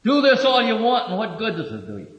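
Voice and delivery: scornful go-ahead, falling off into a brush-off; dismissive. Acted. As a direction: throw it out high, then dismiss it. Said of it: "the tone of his voice was".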